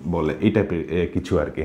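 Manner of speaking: ordinary talk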